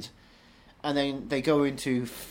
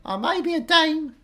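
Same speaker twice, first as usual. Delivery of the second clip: put-on Essex accent; 4 dB louder than usual. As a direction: lay the Essex accent on thick and high.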